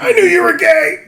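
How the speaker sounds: very high, loud gotcha; mock triumphant squeal. Guts answering Griffith's confession, high squeal.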